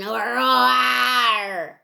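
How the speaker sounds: shouted mock lion roar. Lion puppet greets the children with a playful roar.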